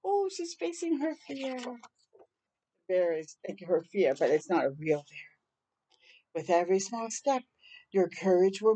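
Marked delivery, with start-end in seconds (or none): none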